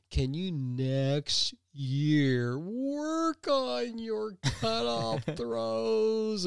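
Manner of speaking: a slow, carefully restrained coach; strained patience. Retold or imitated. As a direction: hold back your anger and say it very slowly. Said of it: "doing everything he can to say".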